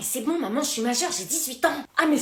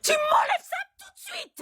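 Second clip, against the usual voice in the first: high, slow furious yell; outraged mother ordering the piercing taken out, shouting; slower than usual. Acted. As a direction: bellow the order, slowly.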